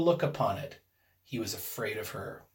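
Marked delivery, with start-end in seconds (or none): none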